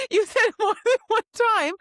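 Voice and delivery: loud, high mock indignation; exasperated scolding. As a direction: scold him in playful outrage. Redoes her scolding of the character.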